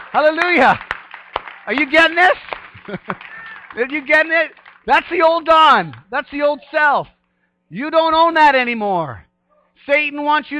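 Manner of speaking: loud, high, rousing preacher's cry to the church; preaching at full force. Real, not acted. Pastor rallies clapping congregation in loud, high, fervent preaching. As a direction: whip the congregation up, voice ringing high.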